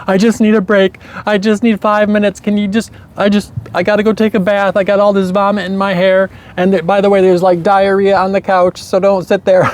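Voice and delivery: high, pleading, frantic new mother; frazzled mom. Retold or imitated. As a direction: beg for a break at the end of her rope. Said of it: "she would be like".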